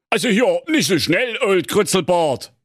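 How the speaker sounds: high, tense Western showdown voice; gunslinger king. Acted. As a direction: play the king squaring up for a duel. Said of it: "sagte der König Pedro".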